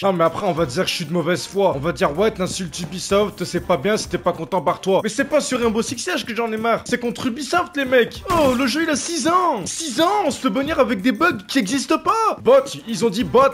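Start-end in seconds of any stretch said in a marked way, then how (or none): none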